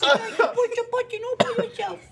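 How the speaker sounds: very high-pitched mocking baby voice; patronizing baby talk. Husband mocking wife's video, in baby talk. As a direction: coo it sky-high, as if to a toddler. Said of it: "just high pitched or it's baby talk".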